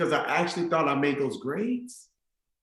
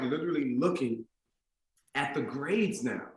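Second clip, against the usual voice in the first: usual speaking voice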